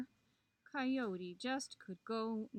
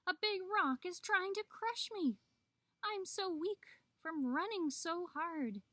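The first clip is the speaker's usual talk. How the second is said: high-pitched, urgent plea; pleading Coyote; 7 semitones higher than usual. A narrator's reading. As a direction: beg a friend for help. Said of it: "Coyote called out".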